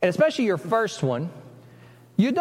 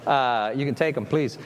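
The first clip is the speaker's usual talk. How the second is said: careless parent; 6 semitones lower than usual. Retold or imitated. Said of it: "it's like".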